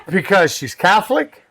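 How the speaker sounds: ordinary talk, nothing marked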